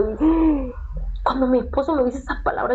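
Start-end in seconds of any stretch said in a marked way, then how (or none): 0.3-1.2 a sharp, high gasp of amazement; startled gasp. Real, not acted. Wife hearing husband's dream gasps in amazement. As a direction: catch your breath in sudden wonder.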